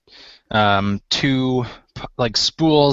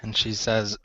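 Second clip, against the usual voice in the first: normal talking voice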